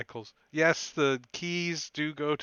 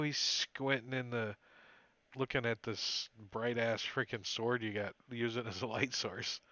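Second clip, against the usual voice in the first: ordinary talk, nothing marked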